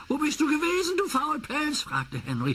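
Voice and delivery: high taunting voice; mocking Henry. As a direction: tease the late engine.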